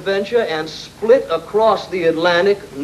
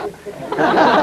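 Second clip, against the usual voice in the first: usual speaking voice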